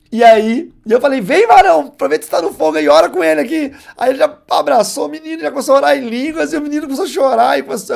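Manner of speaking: loud, high, rising fervour; fervent excitement. Real, not acted. Preacher reliving the Pentecost in the house, loud and fervent. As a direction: call it out like revival fire falling. Pentecost moment, urging friend to pray.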